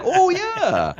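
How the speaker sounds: very high, drawn-out realization; exaggerated, high-pitched penny-drop; mock recognition. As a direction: swoop up high as if it suddenly clicks.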